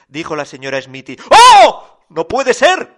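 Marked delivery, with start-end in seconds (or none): 1.3-2.9 loud, high gasping disbelief; shocked Papá Noel. A narrator's reading. Papá Noel hearing it is Christmas already, loud shock. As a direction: burst out with a big Oh, then protest high.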